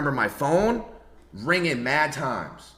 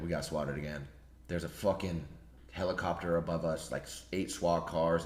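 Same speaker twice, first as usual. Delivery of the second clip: low muttered Norden; 7 semitones lower, 8 dB quieter than usual. Retold or imitated. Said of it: "He's like".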